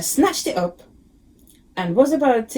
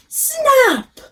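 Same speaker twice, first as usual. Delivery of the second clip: snap sound effect; 5 dB louder than usual. A narrator's reading. Tusk breaks in story.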